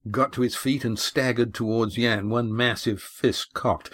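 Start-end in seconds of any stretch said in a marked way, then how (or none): none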